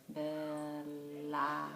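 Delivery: Bella stretched out, each sound held; slow, sound-by-sound Bella for a young reader; said slowly. Shown as a demonstration. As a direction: draw out Bella so every sound is heard. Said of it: "when you say it slow, it works".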